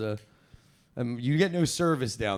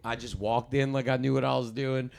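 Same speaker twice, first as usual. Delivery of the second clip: usual speaking voice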